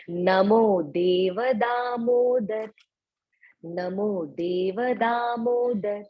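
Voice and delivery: very slow, each syllable drawn out; slow careful recitation. Shown as a demonstration. Teacher reciting the verse slowly for devotees. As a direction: chant it slowly so they catch each sound. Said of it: "pronunciation main है".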